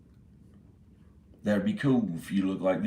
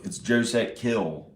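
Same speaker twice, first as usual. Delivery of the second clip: ordinary delivery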